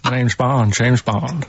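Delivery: barely Scottish Bond attempt; a weak stab at Connery; Sean Connery impression. Shown as a demonstration. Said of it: "That's like Jimmy Stewart".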